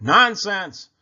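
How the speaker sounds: loud, high motherly scold; cross storybook mother. A narrator's reading. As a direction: dismiss it sharply and loudly. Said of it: "Their mother scolded".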